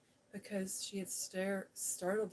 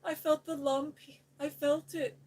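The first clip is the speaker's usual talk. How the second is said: higher, louder choking panic; choked out; 6 semitones higher, 7 dB louder than usual. Acted. Colin chokes out his fear to Mary. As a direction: gasp it out in terror. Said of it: "he choked out".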